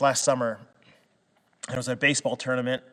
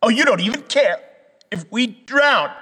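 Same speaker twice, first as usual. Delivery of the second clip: higher, louder mocking whine; sarcastic; 8 semitones higher, 5 dB louder than usual. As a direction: sneer it with heavy sarcasm. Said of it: "There's kind of a sarcasm in it".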